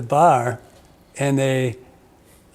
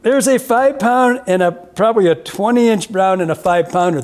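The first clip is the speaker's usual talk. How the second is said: high and loud, thrilled by the catch; excited; 7 semitones higher, 5 dB louder than usual. Real, not acted. Telling a friend about the huge fish he saw, excited and high. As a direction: pitch it up and rush with excitement.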